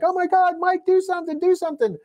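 high, frantic plea for help; panicked girlfriend. Retold or imitated. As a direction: beg him shrilly to act, mock panic. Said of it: "And the girlfriend's like".

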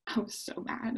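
under-the-breath admission; whispered mock grudge; whispered aside. Real, not acted. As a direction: drop to a whisper, half joking. Recalling the prank with annoyance.

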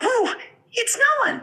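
very high, tiny squeak; squeakier mouse. Acted. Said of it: "make it a little squeakier".

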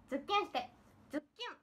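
cute voice. Pretend.

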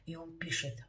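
ordinary delivery

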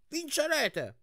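high-pitched eager cheer; excited, guileless rallying cry. A narrator's reading. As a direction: cheer your team on, voice leaping up. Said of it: "mi incitò lui".

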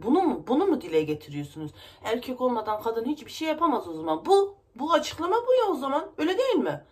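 real anger climbing to a loud, high protest; outraged outburst. Real, not acted. Woman protesting that women can manage without men, outraged. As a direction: challenge them hotly, pitch and volume surging.

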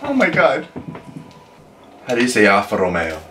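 normal talking voice

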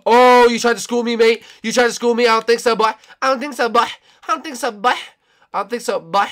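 high put-on taunting voice; high, cocky ridicule; mocking trash talk. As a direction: jeer repeatedly at a failed trick. Mocking player who tried tricks.

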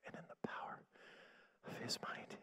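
faint whisper, imitating great weakness; barely able to speak. Sick sister repeating after her coaching minister, feeble whisper. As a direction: whisper it haltingly with no strength. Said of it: "she could barely speak".

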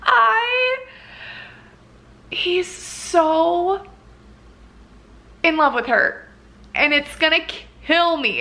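high, breathless gush; high excited gush; overwhelmed fangirling. Real, not acted. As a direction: swoon in disbelief. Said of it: "the gasp I just gasped".

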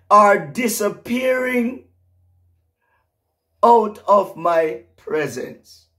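high, loud prophetic preaching, slowing on the key words; fervent pulpit proclamation; preaching at full force. Acted.

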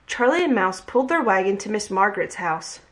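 ordinary delivery

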